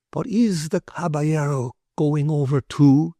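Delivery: lightly voiced Spanish gentleman; polite Spanish merchant. Ramon's voice in dialogue.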